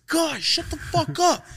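very high, slow squeal; squealing mock voice. Class reacting to the insult, squealing mock voice. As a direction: squeak it out like giggling students.

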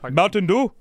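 high, loud mock voice; mimicked driver. Mocking the driver offering Mountain Dew. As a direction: ape him offering a soda.

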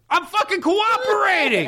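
high, strained scream; mock scream. Retelling screaming at TSA, high mock scream. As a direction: shriek it, hammed up. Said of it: "I just start screaming at the top of my lungs".

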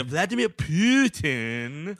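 "Putin" drawn out slowly as "Pyooo-tin"; slow mimicry with a long "pyoo" in "Putin"; fun Putin pronunciation. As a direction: stretch the first syllable of "Putin". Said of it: "a very fun way to pronounce".

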